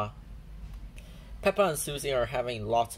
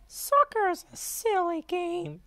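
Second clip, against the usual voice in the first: 1 disappointed Peppa. A narrator's reading.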